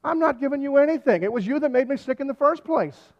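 loud, high mock outrage; indignant refusal. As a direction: turn on God with hammed-up blame. Acted indignant reversal once safely ashore.

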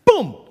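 loud, sharp mock boom; sword-strike boom. Pastor acting Peter's sword strike, a loud sharp boom. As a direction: punch the word like a blow.

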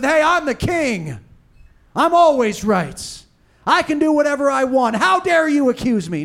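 a self-important ruler bristling at being accused; arrogant king. Retold or imitated. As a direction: throw it back like an offended king. Arrogant king rebuffs the prophet.

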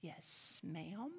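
tiny, soft, half-whispered reply; a little girl mumbling obediently; mock-meek daughter answering mother; meek child's yes ma'am. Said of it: "And she would say".

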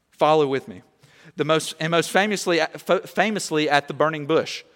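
usual speaking voice

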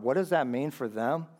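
ordinary talk, nothing marked

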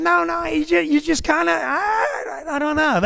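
hammed-up vague stammering; Andy pretending he can't name what's wrong; teasing Andy. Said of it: "Andy said".

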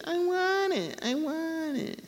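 put-on high whiny child pleading, pitched well up; little kid whining for a toy. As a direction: whine it like a toddler. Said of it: "like a little kid".

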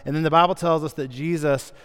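ordinary delivery

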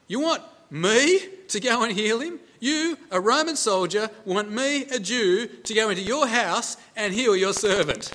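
high, loud challenge, leaning hard on "me"; incredulous question. Acted. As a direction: question it in disbelief, punching each contrast. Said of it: "it's actually a question".

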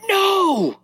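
very high mock-furious squeal; angry toddler. Frustrated toddler answering her dad, high angry squeal. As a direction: imitate a frustrated little kid snapping back. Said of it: "but just angry".

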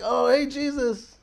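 high, drawn-out casual hail; last-second call. Acted. Preacher as dying criminal on the cross, high hailing call. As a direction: imitate a man calling over to Jesus.

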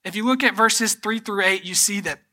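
impassioned preaching. Acted. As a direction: press the point to the church. Preaching to congregation.

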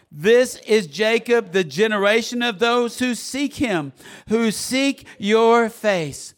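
high, loud, emphatic psalm reading; loud scripture proclamation. Real, not acted. Preacher reads psalm with congregation, loud proclamation. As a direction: proclaim the verse at full preaching force.

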